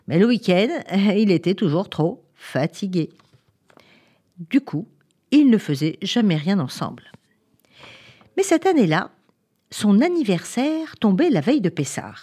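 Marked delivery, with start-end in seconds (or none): none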